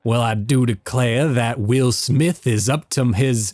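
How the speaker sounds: slow hammed-up Southern gentleman drawl; Southern accent, slow and theatrical; mock Loveless. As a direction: gossip like a pompous Southern villain. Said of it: "he sounds like Loveless".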